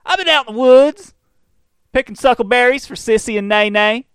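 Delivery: high, loud put-on backwoods voice; hammed-up country bumpkin shouting; mock hillbilly NPC. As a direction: play a yokel NPC running up to your car. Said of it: "like fucking N P Cs in Oblivion".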